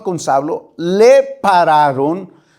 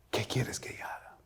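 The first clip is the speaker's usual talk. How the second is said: barely voiced, surrendered whisper; humbled whisper; 6 semitones lower, 22 dB quieter than usual. Preacher voicing a humbled believer to God, whisper. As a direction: breathe the words out in surrender. Saulo answering the Lord.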